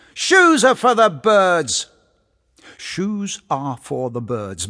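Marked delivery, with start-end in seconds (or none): 0.1-1.9 high, loud, slow sarcastic yell. Acted. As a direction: yell it back over your shoulder, mocking. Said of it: "he yelled sarcastically over his shoulder".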